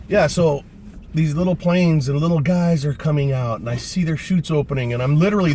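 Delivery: usual speaking voice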